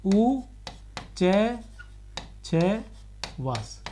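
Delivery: उ च छ वास split into separate slow syllables. Shown as a demonstration. Teacher breaking a word into syllables for students, slowly. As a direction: spell out उच्छ्वास piece by piece. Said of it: "ये real में क्या है?".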